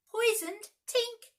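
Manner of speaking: very high, loud alarm; anguished cry. A narrator's reading. Peter finding poisoned Tink, very high and loud. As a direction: cry out in horror. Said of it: "he cried".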